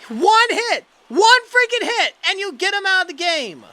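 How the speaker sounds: loud indignant complaint; furious rant. Real, not acted. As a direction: vent at the manager's call. Ranting at the manager.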